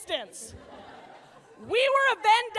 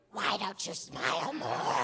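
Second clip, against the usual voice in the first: much lower, slow, creepy creature rasp; Gollum voice; 13 semitones lower, 5 dB quieter, slower than usual. Retold or imitated. Mocking how men sound to her, low slow Gollum voice. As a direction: croak it like Gollum. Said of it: "a Gollum voice".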